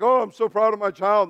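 proud-parent gush, lightly hammed; mock proud parent. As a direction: beam like a bragging parent. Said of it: "There are times you're like".

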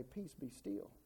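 low, hushed and calm; calm command. Retold or imitated. Jesus stilling the storm from the boat, calmly. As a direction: quietly settle it, imitating unruffled authority. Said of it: "and very calmly say".

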